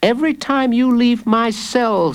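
put-on high-pitched child's voice; knowing child. Jacob reveals what he finds in his cell, knowing. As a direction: point it out like a confident child.

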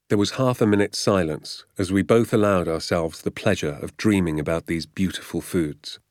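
usual speaking voice

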